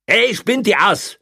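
high, loud, drawn-out yell; angry shout. A narrator's reading. Policeman shouting at rampaging prisoner, angry shout. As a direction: shout angrily through a door. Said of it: "schrie Kreutner durch die Tür".

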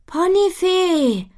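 long high drawn-out call. A narrator's reading. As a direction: call her name across the garden. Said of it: "ruft Butterblume ihr nach".

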